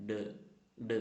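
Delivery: "d" as a short "duh"; letter sound d. Shown as a demonstration. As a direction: say "d" as its sound, not "dee". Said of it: "pronounce ചെയ്യുന്നത്".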